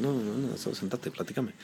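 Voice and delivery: a lightly voiced director telling him to just sit and chat; soft calm director. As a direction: ease him down quietly. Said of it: "me dice".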